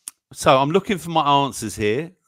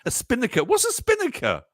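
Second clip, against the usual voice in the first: baffled; 7 semitones higher, 3 dB louder than usual. Real, not acted. Baffled by the word.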